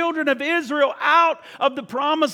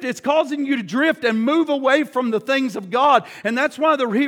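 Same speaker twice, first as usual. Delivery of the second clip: usual speaking voice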